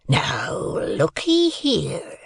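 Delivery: very slow, husky, drawn-out warning; menacing convict. A narrator's reading. Convict tilts the boy back to warn, menacing. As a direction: stretch it out as a rasping threat.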